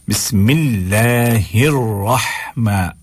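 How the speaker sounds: slow "बिस्मिल्लाहिर" recited with light l; thin lam. Shown as a demonstration. Teacher answering what we do, slow recitation with thin lam. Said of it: "उसको बारीक करके".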